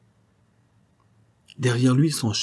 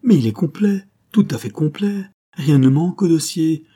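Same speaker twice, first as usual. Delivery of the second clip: high, louder joyful outburst; cry of joy; 7 semitones higher, 5 dB louder than usual. A narrator's reading. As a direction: burst out with relief. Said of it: "poussa un cri de joie".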